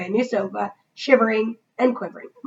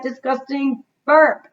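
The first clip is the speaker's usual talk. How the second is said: usual speaking voice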